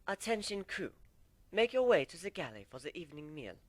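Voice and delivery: tinny announcement over a ship's speaker; ship's AI calling the crew to dinner over the PA. A narrator's reading. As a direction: read it like a ship's intercom call. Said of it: "came over the P A system".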